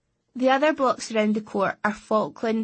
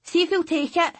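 calling across; 8 semitones higher, 4 dB louder than usual. A narrator's reading. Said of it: "She called across to me".

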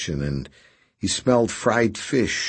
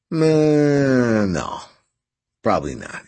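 indecisive; 5 semitones higher, 4 dB louder, slower than usual. Acted. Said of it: "played indecisively with his fork".

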